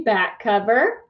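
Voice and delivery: ordinary delivery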